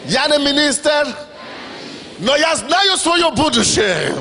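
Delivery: preaching at full force. Acted. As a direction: proclaim it to the hall.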